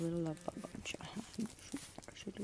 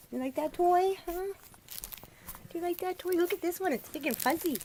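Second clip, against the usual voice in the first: puppy talk; 9 semitones higher than usual. Real, not acted. Owner showing a fuzzy toy to puppies, high coo.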